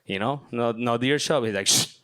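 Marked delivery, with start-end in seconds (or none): 1.7-2.0 sharp imitated "shh"; shushing. Host dad hushes student while deer hunting, imitated shush.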